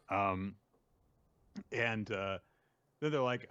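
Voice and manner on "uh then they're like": normal talking voice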